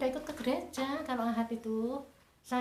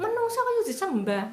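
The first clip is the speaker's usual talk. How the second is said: much higher and louder, indignant; retold scolding; 10 semitones higher, 7 dB louder than usual. Mother reproaching her daughter, high and loud. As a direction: fling the question at her in outrage.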